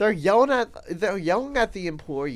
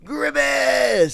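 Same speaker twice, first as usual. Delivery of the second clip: high, loud triumphant yell; a delighted whoop of the mascot name; gleeful shout; 10 semitones higher, 7 dB louder than usual. Real, not acted. Repeats name shouting with glee.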